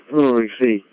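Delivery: ordinary talk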